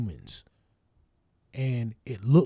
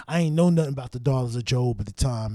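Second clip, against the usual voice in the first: ordinary talk, nothing marked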